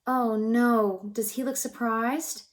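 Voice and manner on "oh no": ordinary delivery, nothing marked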